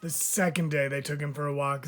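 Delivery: ordinary delivery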